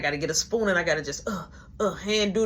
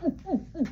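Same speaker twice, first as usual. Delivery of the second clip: choking whoops; 8 semitones higher, 3 dB quieter, faster than usual. Retold or imitated. Still choking on drink.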